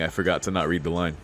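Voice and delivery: normal talking voice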